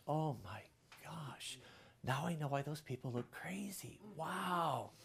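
soft, breathless whispered awe; awed whisper. Retold or imitated. Pastor recalling the moment God's presence hit, whispering in awe. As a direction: hush it like a sudden revelation. Retelling his awe during worship.

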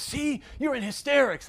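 very high-pitched imitation of dad; squeaky mock accusation; accusing dad. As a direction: crow it triumphantly, way up high. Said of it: "He says".

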